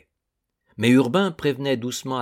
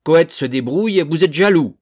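loud, higher accusing shout; 5 semitones higher, 9 dB louder than usual. A narrator's reading. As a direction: call them jealous to their face. Olichon accuse les autres. (Olichon accuse les autres, en criant)

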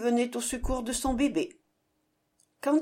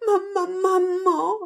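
high, loud panicked cry for mother; terrified cry; 8 semitones higher, 4 dB louder than usual. A narrator's reading. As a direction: scream for mom in terror. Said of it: "cria Ratapon".

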